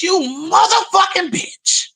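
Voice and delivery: high, slow shouted insult; mock cussing release. Shown as a demonstration. Showing viewers how cussing feels like release, in a high slow shout. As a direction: let it rip like a release.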